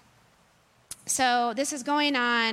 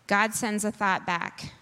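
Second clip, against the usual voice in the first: usual speaking voice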